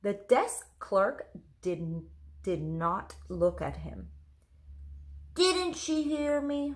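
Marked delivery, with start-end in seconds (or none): 5.4-6.7 put-on boy's inner wondering. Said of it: "Ron wondered".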